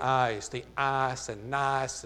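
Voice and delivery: slow Southern drawl on ice and nice; Southern accent I's. Shown as a demonstration. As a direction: stretch the I's Southern style. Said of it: "I had a much more Southern accent".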